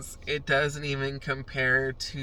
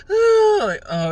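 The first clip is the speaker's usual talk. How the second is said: mock sob; 13 dB louder, slower than usual. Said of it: "I will fucking cry the whole fucking time".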